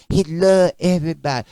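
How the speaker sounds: a hint of a careless churchgoer shrugging; mildly mocking voice for popular belief; mocking the people. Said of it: "That's all you always hear the people say".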